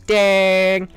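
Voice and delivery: high, loud sung ding; sung ding chime. Pretend. Sings a chime.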